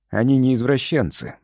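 ordinary delivery

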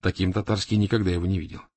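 ordinary delivery

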